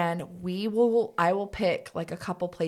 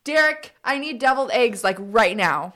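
calling out; 4 semitones higher, 7 dB louder than usual. Pretend. Calling out to absent husband.

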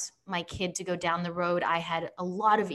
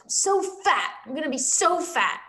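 put-on upset young daughter; 7 semitones higher, 3 dB louder than usual. Daughter quoted while upset.

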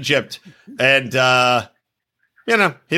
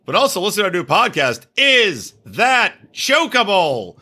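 mock podcast promo; 5 semitones higher than usual. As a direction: stretch the title like a show host. Mock podcast promo, title announced.

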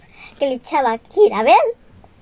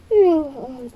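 plain reading voice

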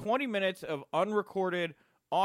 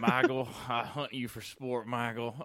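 DDP impression; 4 semitones lower than usual. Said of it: "doing my best D D P impression".

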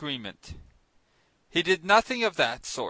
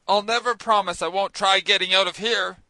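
shouting; 3 semitones higher, 7 dB louder than usual. A narrator's reading. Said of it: "Ned Land shouted".

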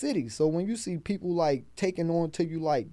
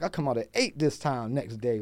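normal talking voice

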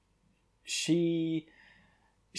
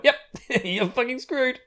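laughing through it, pitched up; mock someone's doom through giggles; high, amused mock taunt; imitated gleeful laughing; 10 semitones higher than usual. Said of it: "She starts laughing her ass off".

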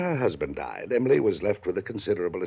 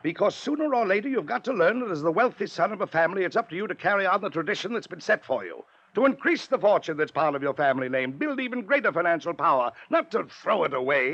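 raised, higher, insistent lecturing; stern lecture; 8 semitones higher than usual. Acted. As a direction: hammer home the family duty. Uncle lecturing nephew on duty.

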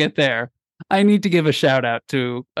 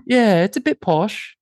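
put-on posh British, pitched up; posh British accent; 4 semitones higher than usual. Shown as a demonstration. Host showing the posh one, British accent. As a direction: repeat it as a British toff. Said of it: "Oh, that's the posh one".

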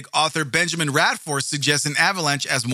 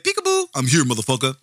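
taunting avalanche; 2 semitones lower than usual. Pretend. Vlogger mocking the avalanche theory, squeaky playful taunt. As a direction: tease like a cheeky avalanche. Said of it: "It, it wouldn't have just said".

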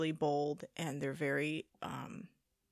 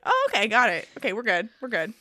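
high yelp then quick relief; exaggerated oh okay; mock relieved surprise; 4 semitones higher, 8 dB louder than usual. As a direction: play it surprised then reassured. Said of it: "And I was like".

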